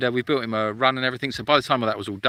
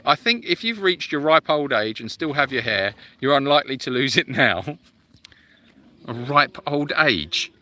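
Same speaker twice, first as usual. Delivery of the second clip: usual speaking voice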